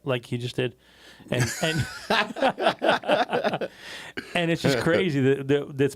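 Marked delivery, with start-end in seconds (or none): 1.9-4.3 long high-pitched laugh; real laugh, tailing down; laughter. Real, not acted. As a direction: burst out laughing at a friend. Teasing someone who fell asleep.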